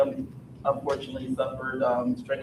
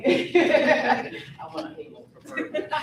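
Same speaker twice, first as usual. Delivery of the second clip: slow, high words through laughter; laughing; 11 semitones higher, slower than usual. Real, not acted. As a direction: laugh while getting it out. Laughing nervously at mic.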